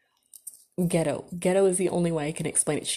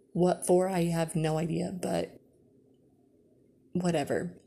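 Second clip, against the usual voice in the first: ordinary talk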